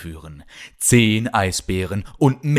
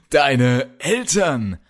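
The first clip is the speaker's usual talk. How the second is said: villain's laugh into a slow, higher question; 6 semitones higher, slower than usual. A narrator's reading. Polar bear leader scoffing at Kalle's question, laughing. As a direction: laugh first, then draw the words out mockingly. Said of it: "lachte Kralle".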